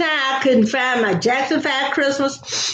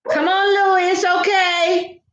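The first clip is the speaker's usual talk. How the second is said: calling the dog; 10 semitones higher than usual. Real, not acted. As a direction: shout across the house to settle the dog.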